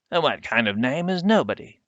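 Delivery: put-on high, shocked old woman's objection; Mother Slaughter aghast at the name; scandalized. Shocked old woman, scandalized.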